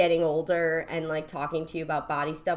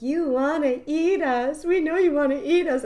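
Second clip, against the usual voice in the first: tempting dancing scallops; 8 semitones higher, 5 dB louder than usual. Retold or imitated. Scallops dancing to tempt him, high teasing voice. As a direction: coax him in a high cartoon voice. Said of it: "they were, like, dancing for him".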